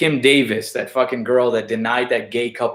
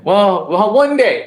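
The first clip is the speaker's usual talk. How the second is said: heated; 6 semitones higher, 5 dB louder, slower than usual. Real, not acted. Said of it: "I'm getting a little heated".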